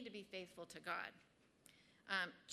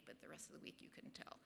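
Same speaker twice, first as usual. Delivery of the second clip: whispered aside; 9 dB quieter, faster than usual. Real, not acted. Speaker confessing to the group her weekday lapses, whispered aside.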